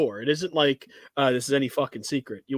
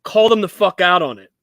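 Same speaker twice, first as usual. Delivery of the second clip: emphatic; 4 semitones higher, 7 dB louder than usual. Real, not acted. Urging callouts of shops.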